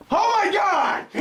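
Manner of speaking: startled shout. Acted. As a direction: yell it out in shock. Kids playing, sudden fright.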